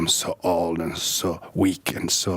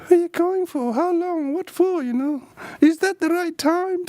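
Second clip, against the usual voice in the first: mock nagging father, much higher; control-freak father. Father questioning his son about going out, mock nagging and high. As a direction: grill the son like a fussy parent. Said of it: "Type of thing".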